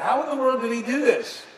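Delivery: high, loud astonished question; marveling. Acted. Disciples watching Jesus feed the crowd, acted amazement. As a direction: imitate amazed onlookers, voice jumping up. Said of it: "marveled by that".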